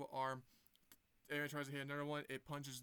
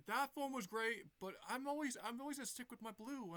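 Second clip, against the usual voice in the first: higher, upbeat hero voice; 8 semitones higher than usual. Acted. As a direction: say it proudly like a cartoon hero. Sonic voiced in retold scene.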